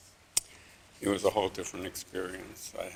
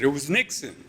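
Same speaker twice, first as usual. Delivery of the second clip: yelled from the back; 5 semitones higher, 4 dB louder than usual. Retold or imitated. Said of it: "I just yelled out".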